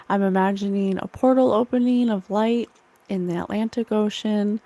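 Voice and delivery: ordinary talk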